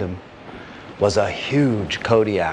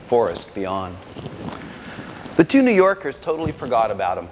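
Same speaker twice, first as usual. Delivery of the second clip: ordinary delivery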